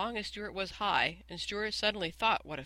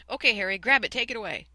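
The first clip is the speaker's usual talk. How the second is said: loud, higher-pitched command; urgent order; 5 semitones higher, 9 dB louder than usual. A narrator's reading. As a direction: call out the order with punch. Stuart calls out an order.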